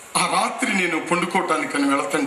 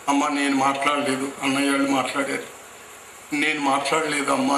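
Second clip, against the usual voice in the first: lower, meek plea to mother; pleading humbly; 4 semitones lower than usual. Retold or imitated. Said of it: "అని దీనంగా చెప్పి". (తల్లిని దీనంగా వేడుకుంటూ)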